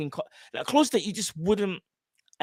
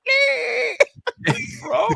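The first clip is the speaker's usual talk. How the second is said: put-on high, squeaky little girl saying Lee; toddler voice. Dad imitates his little daughter greeting Uncle Lee, squeaky and high. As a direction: play a toddler trying a name. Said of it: "she can't really say Lee".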